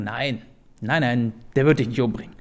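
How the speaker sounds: low, soft dismissal; quiet denial. Acted. Jonathan disagrees that Saul will kill David, quiet denial. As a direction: brush the fear aside under your breath.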